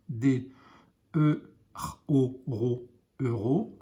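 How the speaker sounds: put-on slow letter-by-letter blending for a child; sounding out letter by letter. Shown as a demonstration. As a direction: sound out each letter of sœur, then blend into sœur. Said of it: "teacher sounds out letters".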